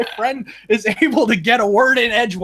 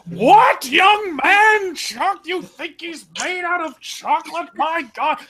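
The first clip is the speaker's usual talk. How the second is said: mock scolding teacher; 9 semitones higher, slower than usual. Teacher scolding student, retold.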